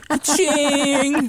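high, bright ka-ching; cash register ka-ching. Pretend. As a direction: sing out the register sound.

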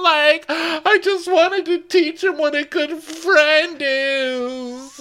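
very high, loud, slow whiny wail; mock sobbing Victoria. Co-host mimicking Victoria's tearful FaceTime confession, high loud wailing. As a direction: blubber it out like a melodramatic crybaby.

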